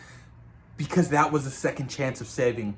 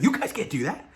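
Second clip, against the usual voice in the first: higher-pitched incredulous question; acted-out disbelief; 7 semitones higher than usual. One Spider-Man questioning the others, in mock disbelief. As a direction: imitate someone amazed by the others.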